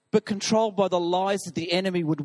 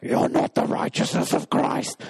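higher whispered taunt from the demon; demon's whisper; 5 semitones higher than usual. Acted. Demon whispers to the man that he's not righteous, hissing. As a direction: hiss the denial with a whisper.

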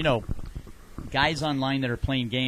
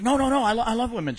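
high, louder flustered denial; mock protest; 10 semitones higher, 4 dB louder, faster than usual. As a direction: squeak out a hasty denial. Guy protesting, retold.

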